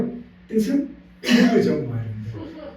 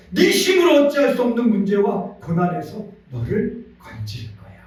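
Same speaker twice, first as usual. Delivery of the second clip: the Father's promise, preached with rising fervor; impassioned sermon voice, building then settling; fervent preaching; 4 semitones higher than usual. Acted. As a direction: proclaim it like God speaking to his child. God's voice in preaching.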